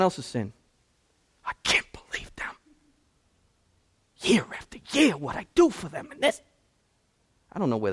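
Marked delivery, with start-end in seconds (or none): 1.4-6.4 mock whisper turning into a high indignant whine; indignant complaining. Churchgoer mocked for griping about others' sin, whispered then high whine. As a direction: imitate a self-righteous grumbler.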